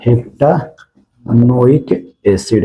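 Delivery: हेप्टानोइक broken slowly into parts, with pauses; sounded out. Shown as a demonstration. Teacher naming the seven-carbon acid for students, sounded out. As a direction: draw out हेप्टानोइक piece by piece.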